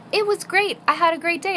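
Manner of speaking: put-on high-pitched little girl's voice; chirpy young daughter. Fourth-grader tells mom about her school day, chirpy. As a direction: answer like an upbeat schoolchild.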